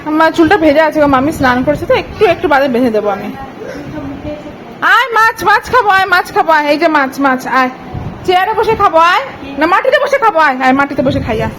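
put-on high, loud sing-song baby talk. Mother asking the baby what she eats, high baby talk. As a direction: chirp at the baby over her watermelon.

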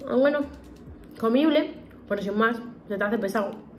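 sticky food in mouth; talking while chewing. Real, not acted. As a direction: mouth partly full. Seen 0: Bites mochi pieces at 4.0–5.3s; mouth working while talking throughout.

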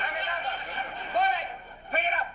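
barked high order; angry shout. Acted. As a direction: yell it at the clumsy clerk. Boss yelling at employee.

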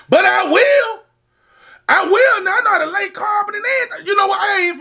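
high, fast hyped brag; excited bragging. Real, not acted. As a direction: brag it up, all fired up.

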